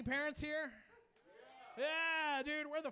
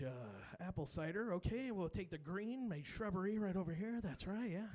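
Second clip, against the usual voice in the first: Bob Ross voice; 3 semitones lower than usual. Retold or imitated. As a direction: coo it gently like Bob Ross. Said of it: "be like".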